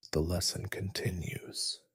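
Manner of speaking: plain reading voice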